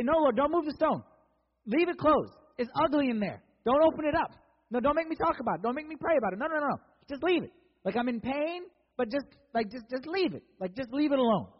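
loud, high-pitched pleading refusal; pleading protest. Acted. We voiced refusing Jesus' command.

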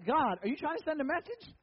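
high, rising question; mock questioning. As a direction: ask it upward in playful disbelief. Mock question put to God.

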